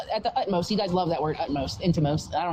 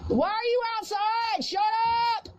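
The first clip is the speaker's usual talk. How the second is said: yelling; 10 semitones higher than usual. Real, not acted. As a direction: shout at the dog's owner. Yelling at dog and owner.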